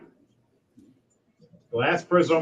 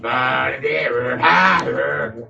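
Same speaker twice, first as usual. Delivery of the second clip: chattering little kobold creatures; kobold voices; 2 semitones higher, 3 dB louder, much slower than usual. Acted. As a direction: imitate kobolds yelping and tittering. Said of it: "More with the kobolds going".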